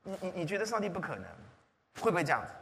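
usual speaking voice

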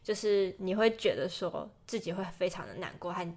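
usual speaking voice

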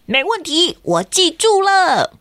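put-on high, slow proud boy voice; Kenta confident. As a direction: promise it like a proud little boy. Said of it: "说".

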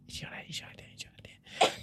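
hushed, voiceless whisper. Real, not acted. Repeating the play's title aside, whispered. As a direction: repeat it under your breath. Children prompting title under breath.

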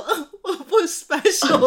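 drawn-out whiny sob, half laughing; slow tearful pout with a laugh in it; mock crying whine. Retelling to friend how she cried to boyfriend, mock sobbing whine. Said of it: "我就哭，我就说".

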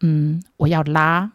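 slow deadpan. Retold or imitated. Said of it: "长笛老师这时候就回他".

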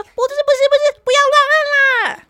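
put-on girl shrieking at the helper not to press it; panicked shout. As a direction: yell to stop the button press. Girl stops helper pressing button.

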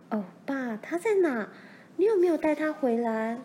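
put-on high-pitched, eager girl's voice; eager questioning. A narrator's reading. Daughter asks father after their friend, eagerly. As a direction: ask eagerly, like an excited child.

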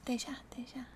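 near-silent unvoiced whisper. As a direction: barely breathe the words out. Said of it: "你学一下". (小声到几乎听不见的耳语)

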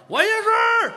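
high, loud proud claim; hammed-up proud father shouting. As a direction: thump your chest and shout it proudly. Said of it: "一拍自个儿".

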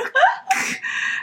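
airy high-pitched giggle; high breathless laugh. Real, not acted. Daughter laughs after imitating dad, high airy giggle.

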